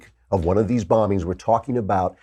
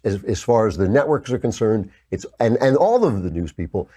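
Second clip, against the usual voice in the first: normal talking voice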